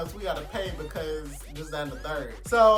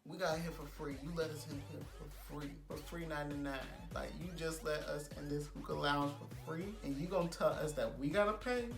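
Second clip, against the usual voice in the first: quiet; 3 semitones lower, 10 dB quieter than usual. Retold or imitated. Drunk confronting security guard.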